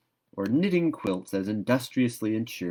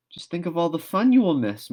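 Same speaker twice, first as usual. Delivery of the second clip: high, louder mournful coaxing; 10 semitones higher, 6 dB louder than usual. A narrator's reading. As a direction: lament what she'll lose. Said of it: "mourned Diana".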